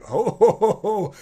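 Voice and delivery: high, loud "ho, ho"; acted dodging whoops. Fighter flipping around a warhammer's swings, mock whooping. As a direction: whoop as if skipping clear of a swing.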